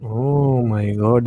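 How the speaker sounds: ordinary delivery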